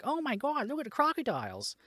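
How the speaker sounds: high-pitched gushing imitation of tourists; mock excited tourists. Mimicking gawking tourists at crocodiles.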